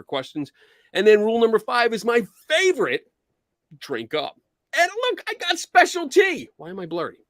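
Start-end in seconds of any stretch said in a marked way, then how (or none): 4.8-6.5 excited. Real, not acted. Showing off her special tea.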